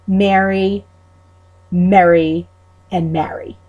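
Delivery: "Mary", "Merry", "Mary" each vowel distinct; three vowels kept apart, Long Island; Long Island Mary merry marry. Shown as a demonstration. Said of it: "So you have".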